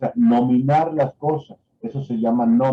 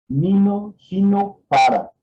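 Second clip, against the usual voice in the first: higher, louder, each word set apart; demonstration phrases; 7 semitones higher, 3 dB louder, slower than usual. Shown as a demonstration. Teacher demonstrating to students, words said higher and louder one by one. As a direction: call out each test word for the patient. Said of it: "repetir las frases como".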